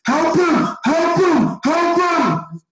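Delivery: high-pitched frantic shout from the sideline; mock coach yelling. Coach shouting from the sideline at the dribbler, frantic high yell. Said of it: "just go".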